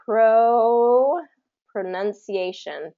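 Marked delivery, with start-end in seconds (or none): 0.0-1.2 one syllable held long; drawn-out teacher's demo of a single syllable; broken apart. Shown as a demonstration. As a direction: stretch the first syllable out by itself. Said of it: "break it apart".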